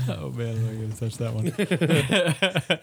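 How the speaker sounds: real laugh, slow and amused. Real, not acted. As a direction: laugh it off while declining the joke. Reacting to crude joke.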